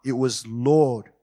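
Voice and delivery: "Lord" slow and weighty; stress on Lord. Shown as a demonstration. Preacher contrasts "Lord" with sir and brother. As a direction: press "Lord" firmly. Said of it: "emphasis on the word".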